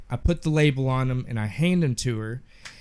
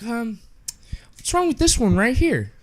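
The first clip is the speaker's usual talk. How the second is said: higher mock customer voice, mildly whiny; picky customer; 8 semitones higher than usual. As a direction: play the fussy customer lightly, pitch up.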